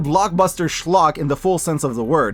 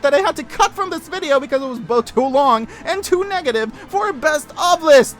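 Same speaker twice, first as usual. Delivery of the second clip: rising exasperated tirade; escalating rant; 10 semitones higher than usual. Real, not acted. Ranting about sequel's mistakes.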